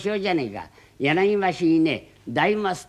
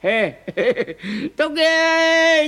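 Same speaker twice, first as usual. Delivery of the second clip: high slow yell to clear the way; loud drawn-out shout; 12 semitones higher, 6 dB louder, much slower than usual. Acted. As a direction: bellow them out of the way.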